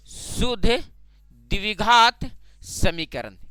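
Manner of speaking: शुद्ध, द्विघात, समीकरण drawn out with clear gaps; slow dictation. Shown as a demonstration. Term repeated slowly for the class. (शुद्ध द्विघात समीकरण रुक-रुक कर, खींचकर)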